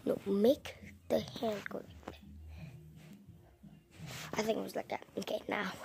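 very soft whispered instructions; hushed behind-the-scenes coaching; whispered direction. Real, not acted.

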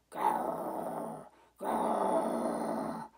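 very low, slow growling; tiger growl. Shown as a demonstration. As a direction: growl deep like a distant tiger. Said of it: "It sounded like this".

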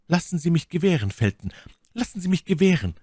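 high, hurried, agitated plea; große Aufregung. A narrator's reading. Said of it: "rief My Lady in großer Aufregung". (aufgeregt flehend, mit hoher Stimme)

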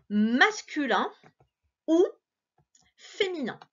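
masculin drawn out slowly, then féminin; masculin, a pause, then féminin; slow contrast. Shown as a demonstration. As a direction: weigh masculin against féminin. Teacher spells out the choice.

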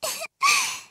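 high rising giggle; teasing giggle. Acted. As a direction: giggle playfully.

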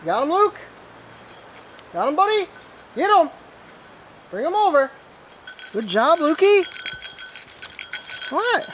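very high dog-praise voice; squeaky praising a dog. Real, not acted.

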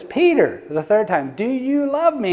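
a pained, searching plea; Jesus pleading. Retold or imitated. Jesus asks a third time.

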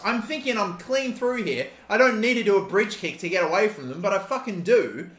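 usual speaking voice

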